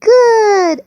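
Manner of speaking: high, loud, cheering; delighted praise. Real, not acted. Parent praising a child's turtle, excited. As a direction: cheer with a high falling swoop.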